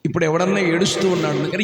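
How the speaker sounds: ordinary talk, nothing marked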